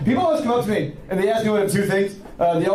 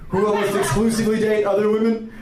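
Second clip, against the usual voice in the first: ordinary talk, nothing marked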